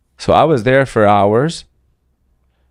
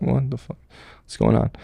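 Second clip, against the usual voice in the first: softer, stunned disbelief; shocked hush; 6 dB quieter than usual. Retold or imitated. Soldier reaching the town, softly shocked. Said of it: "And I'm like".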